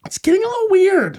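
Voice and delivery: silly falsetto catchphrase; high squeaky voice. Pretend. Running joke, squeaky put-on voice.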